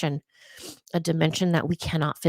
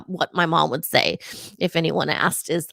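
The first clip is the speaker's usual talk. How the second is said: ordinary talk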